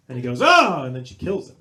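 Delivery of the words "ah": sudden loud, high scream; mock scream. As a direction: scream out as the victim finally caught. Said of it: "But he never screams".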